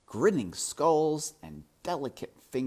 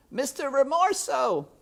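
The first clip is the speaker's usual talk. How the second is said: much higher, louder call; shout; 9 dB louder than usual. A narrator's reading. Despero shouts to the rat in the dark. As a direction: call out in alarm. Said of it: "he shouted".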